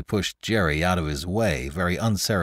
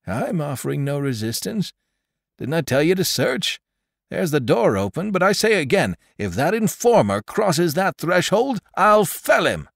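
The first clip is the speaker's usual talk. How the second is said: higher and louder, a furious oath; furious threat; 10 semitones higher, 4 dB louder than usual. A narrator's reading. Old Jones threatening the drunken informer, higher and louder. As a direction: swear it at the drunk.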